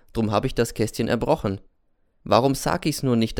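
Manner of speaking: ordinary delivery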